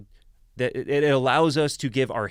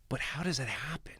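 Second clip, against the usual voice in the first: whispered in awe; 10 dB quieter than usual. Real, not acted. Marvelling to friends about butterfly migration, whispered. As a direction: hush it like a secret of nature.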